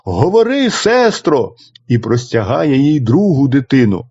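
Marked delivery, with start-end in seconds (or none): 0.1-1.5 higher, urgent plea; second brother calls to his sister; calling out. A narrator's reading. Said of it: "гукає". (другий брат гукає до сестри, вище)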